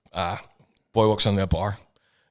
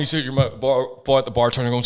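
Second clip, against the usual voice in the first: ordinary delivery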